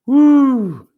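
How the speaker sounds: loud woo cheer; excited whoop. Real, not acted. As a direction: let out a celebratory whoop. Relief after finishing the stats.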